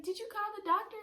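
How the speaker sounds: high, loud mock demand; hammed-up shrieked question; frantic mom. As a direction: imitate a panicking mother yelling a question. Imitates frantic yelling mom.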